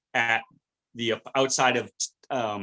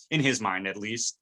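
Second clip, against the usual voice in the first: normal talking voice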